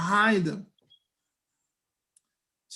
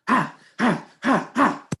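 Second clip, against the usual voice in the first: sharp mimicked "ha" bursts; imitated snatching noises; 4 semitones higher, slower than usual. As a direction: punch out each grab as a short "ha". Imitates hair being snatched out.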